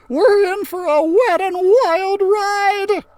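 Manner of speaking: high, slow, loud thrill-ride voice; excited kid. Acted. Carlos in the washing machine, high and thrilled. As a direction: stretch it out like a rollercoaster cry.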